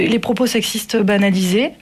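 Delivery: ordinary delivery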